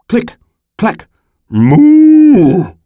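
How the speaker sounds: high, loud drawn-out cow moo; mooing cow sound. A narrator's reading.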